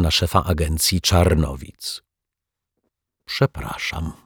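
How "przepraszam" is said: soft, ashamed apology; embarrassed detective apologising quietly to his rival. Acted. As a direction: say it softly, head down. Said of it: "powiedział cicho".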